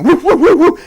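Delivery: loud, high yapping barks; imitated dog barks; dog barking. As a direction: bark like a pack of dogs. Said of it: "the dogs are barking".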